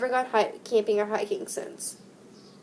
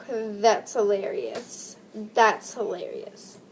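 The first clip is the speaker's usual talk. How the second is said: slow amused; 2 semitones lower, much slower than usual. Real, not acted. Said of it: "slowed after sigh".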